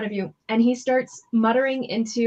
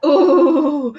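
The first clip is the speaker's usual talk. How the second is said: impressed ooh; 5 semitones higher, 7 dB louder than usual. Real, not acted. Reacting to player's snap.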